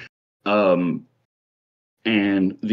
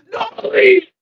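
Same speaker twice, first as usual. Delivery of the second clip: very high, squeaking wail of a name; screaming; 3 dB louder than usual. Acted. Mister Light hearing of Dolly, high anguished scream. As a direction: shriek it in theatrical anguish. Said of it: "and he's screaming".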